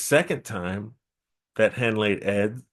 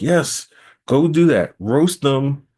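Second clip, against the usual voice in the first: loud, higher insistent order; firm command; 5 semitones higher, 7 dB louder, slower than usual. Acted. Eshu firmly telling Mofani to roast the birds, loud. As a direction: push it at him like a firm instruction.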